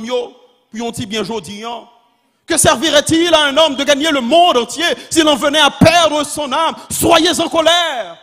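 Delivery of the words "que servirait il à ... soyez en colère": high, shouted sermon climax; full-force preaching. Real, not acted. Preacher challenging the faithful to be angry, shouted preaching. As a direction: cry it out to the whole congregation.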